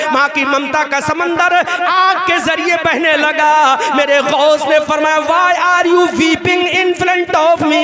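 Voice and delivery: loud sermon swell, English quote slowly drawn; fiery preaching. Acted. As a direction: pour it out loud, stretching the son's words. Climactic preaching, slow English quote. (ज़ोरदार, खिंची हुई तक़रीर)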